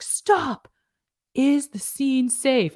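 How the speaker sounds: high, drawn-out mock call-out; hammed-up safety check. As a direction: call a slow, showy halt like a trainee. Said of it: "they look around, they're like".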